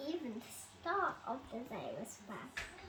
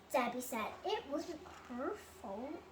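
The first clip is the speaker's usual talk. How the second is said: usual speaking voice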